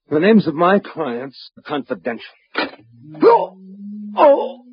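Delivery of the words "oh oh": sharp high cries of pain. Acted. Detective crushed by the Martian ray, crying out in pain. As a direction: cry out as the ray squeezes him.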